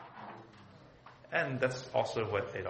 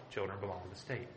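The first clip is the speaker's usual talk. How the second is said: repeated softer and lower, sinking; quiet echo; 5 semitones lower, 8 dB quieter than usual. Shown as a demonstration. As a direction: let the repeat drop to a low, hushed echo.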